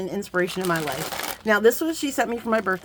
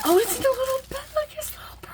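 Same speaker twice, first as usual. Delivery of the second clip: much higher, squeaky delight at a little bag; delighted squeal; faster than usual. Real, not acted. Unwrapping a gift from a friend, high delighted squeal. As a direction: squeal over the tiny bag.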